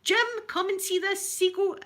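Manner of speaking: mum calling out. Retold or imitated. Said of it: "my mum's going".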